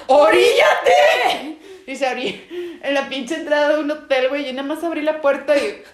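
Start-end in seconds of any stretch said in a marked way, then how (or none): 0.1-1.6 slow, high, loud yell with a laugh; laughing shouted order; urgent shout. Retold or imitated. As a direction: bark at the driver to pull over. Ordering driver to pull over.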